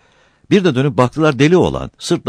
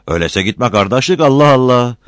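rising to a loud, higher exclamation; sullen exasperation; 7 semitones higher, 5 dB louder than usual. A narrator's reading. Brother-in-law refusing to help Battal, sullen exasperation. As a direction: grow exasperated, louder at the end. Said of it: "suratına asmıştı".

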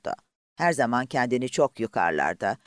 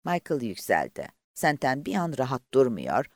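plain reading voice

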